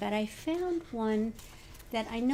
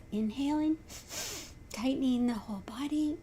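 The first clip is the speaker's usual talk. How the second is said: meditation leader with long pauses between phrases; spacious breathing-exercise instructions; guided meditation; 4 dB quieter than usual. Real, not acted. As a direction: guide them gently through each breath, letting phrase ends lift or settle.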